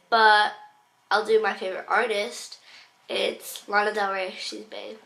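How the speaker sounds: ordinary talk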